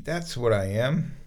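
normal talking voice

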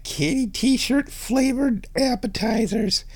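rises into high pet voice. Real, not acted. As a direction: slide up into cooing at a cat. Baby talk to the cat.

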